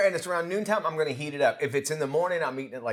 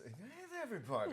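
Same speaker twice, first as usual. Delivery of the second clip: imitating cartoon voice; 5 semitones higher, 16 dB quieter, faster than usual. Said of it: "You sounded like that cartoon character voice".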